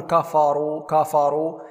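كفروا with dark, backed "kaafaa" vowels; wrong heavy faa. Shown as a demonstration. Teacher shows the mistake twice, heavy faa in كفروا. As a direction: thicken the first vowels of كفروا. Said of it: "هنا لا نقول".

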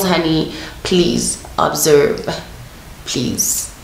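normal talking voice

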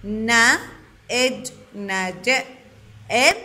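ن، إج، نج، إم slowly, one sound at a time. Shown as a demonstration. Teacher spelling out نجم for children, sound by sound. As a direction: separate the sounds of نجم with pauses. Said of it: "نيجي لعند كلمة".

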